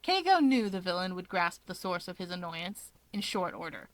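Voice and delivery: ordinary delivery, nothing marked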